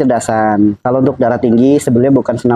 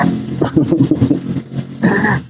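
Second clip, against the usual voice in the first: breathy laugh; 3 semitones higher, 4 dB quieter than usual. Real, not acted. Still laughing at the joke.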